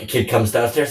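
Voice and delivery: ordinary talk, nothing marked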